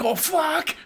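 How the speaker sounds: ordinary talk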